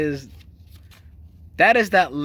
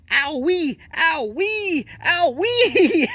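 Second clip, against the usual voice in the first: high-pitched mimicked wail of pain; hammed-up scream, mocking a man in pain; 9 semitones higher, slower than usual. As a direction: imitate a high, silly yelp of pain. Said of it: "he's really screaming".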